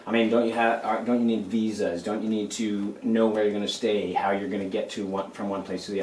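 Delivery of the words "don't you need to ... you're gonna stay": normal talking voice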